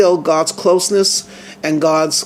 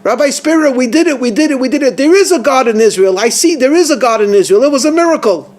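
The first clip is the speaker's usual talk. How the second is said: high, louder, rushing cry of joy and disbelief; hysterical elation; 7 semitones higher, 4 dB louder, faster than usual. Retold or imitated. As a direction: act out the free thinker overcome by a miracle. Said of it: "this free thinker who's hysterical. He's crying and laughing".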